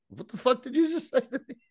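squeaky high-pitched disbelief at normal volume; imitating a speechless stare in a tiny high voice; mock incredulous. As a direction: shoot way up high, as if stunned by what was said. Acting out Data's stunned look.

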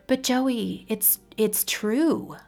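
high, slow, halting insistence; insisting. Acted. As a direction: press the point gently. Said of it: "Liliana insisted".

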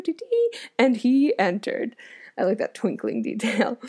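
plain reading voice

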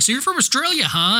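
high, surprised question; mock surprise. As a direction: ask it with fake astonishment. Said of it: "they're like".

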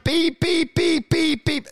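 loud high censor beeps, yelled. Pretend. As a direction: yell the censor bleeps like a TV bleep. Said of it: "is me yelling".

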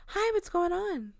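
mock sweetness from a woman playing dumb; fake innocent. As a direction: sound innocent while you block the door. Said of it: "completely two different vibes".

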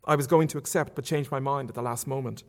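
usual speaking voice

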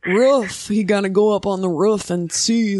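put-on grandma voice, "roof" as ruff; grandmother impression. Guest imitating grandmother, put-on folksy voice. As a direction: mimic grandma's short-voweled "roof". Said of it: "my grandmother talks like that".

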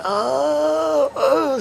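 the king wailing over his elbow; mock screaming. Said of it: "and he's screaming".

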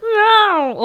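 high, loud cat meow; mock meow. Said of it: "and she's like".

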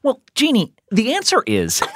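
high, polished newscaster read; news reporter voice. Pretend. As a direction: announce it like the evening news. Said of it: "in his news reporter voice".

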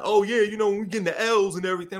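hammed-up street slang; acting urban. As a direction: hype it up like one of the guys. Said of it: "I had to, like, understand how to be like".

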